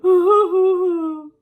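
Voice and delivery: loud, high boo-hoo wail; mock blubbering. Nana acting out the bear crying on, loud mock blubbering. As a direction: blubber like a big crybaby bear. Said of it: "the bear blubbers on".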